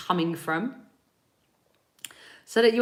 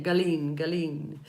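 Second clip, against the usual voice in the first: ordinary talk, nothing marked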